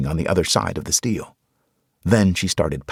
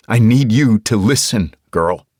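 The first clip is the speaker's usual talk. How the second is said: louder, cutting her off firmly; firm raised voice; 4 semitones higher, 8 dB louder than usual. Acted. Injured man silencing the girl, firm and loud. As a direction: stop her in her tracks. Mitch cuts Tasha off sharply.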